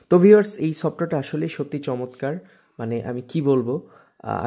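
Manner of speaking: ordinary talk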